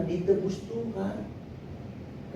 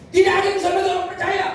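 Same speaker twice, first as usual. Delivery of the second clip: high, loud, rapid scolding; sharp rebuke; 12 semitones higher, 5 dB louder, much faster than usual. Shown as a demonstration. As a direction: rebuke them at full pitch. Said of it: "Tuhan bentak orang ini". (membentak dengan suara tinggi dan cepat)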